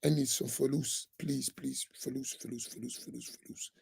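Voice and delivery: a pitiful mock plea dying down to a whisper; whiny beggar. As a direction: beg weakly for money until the voice almost vanishes. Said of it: "That's what I hear all the time".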